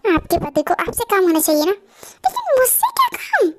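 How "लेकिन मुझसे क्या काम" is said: slow, high, laughing question; amused puzzlement. Acted. Asking why the boss wants him, playfully amused.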